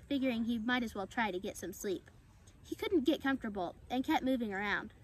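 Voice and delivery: ordinary delivery, nothing marked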